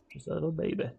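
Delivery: cooing at his tiny new city; baby talk. Pretend. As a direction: coo at the city like an infant.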